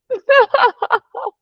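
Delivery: high burst of real laughter. Real, not acted. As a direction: let out a quick laugh. Laughter after joking remark.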